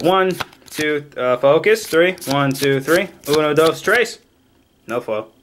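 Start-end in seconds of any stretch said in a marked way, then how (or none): none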